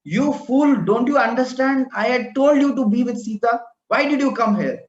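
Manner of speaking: an elder brother yelling at his disobedient brother; angry shout. Acted. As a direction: shout him down in anger. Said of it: "Ram starts shouting at Lakshman".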